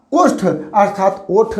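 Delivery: ओष्ठ spoken slowly with a clear ष्ठ cluster, then the everyday ओठ; careful pronunciation. Shown as a demonstration. Teacher contrasting the formal and everyday words, slowly. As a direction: enunciate ओष्ठ fully, then the plain word. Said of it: "सही भाषा में हम क्या बोलेंगे".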